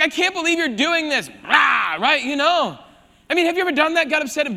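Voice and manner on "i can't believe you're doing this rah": higher, louder hammed-up fit ending in a growled "Rah"; mock angry outburst. Pastor acting out Moses losing his temper, loud mock rage. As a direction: play Moses throwing a tantrum, big and mock. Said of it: "He's like".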